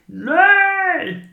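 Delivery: a high, loud cry of no, held back from full volume; a held-back scream demo; toned-down scream. Shown as a demonstration. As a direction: shout no, but hold it back. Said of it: "jag kan inte skrika så högt".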